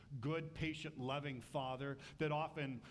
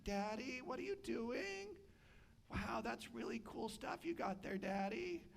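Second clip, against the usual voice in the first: put-on much higher, wide-eyed little kid; curious child; 7 semitones higher than usual. Child admiring daddy's digging, high kid voice. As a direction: play an impressed small child. Kid asking Achan about hole.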